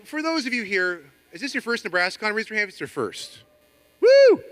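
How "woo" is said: loud high whoop; excited cheer. Real, not acted. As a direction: cheer them on. Cheering first-time attendees.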